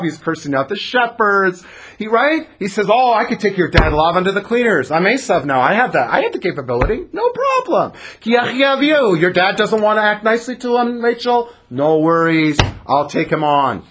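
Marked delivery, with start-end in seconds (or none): 2.9-13.8 cocky swaggering brother, a notch louder; a hint of a boastful tough guy showing off to Rachel; Esau bravado. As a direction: brag like you can take anyone on. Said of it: "That's Esau bravado".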